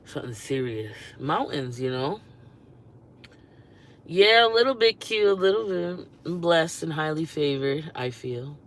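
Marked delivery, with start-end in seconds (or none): 4.0-7.9 playful brag. Real, not acted. Bragging about being cute.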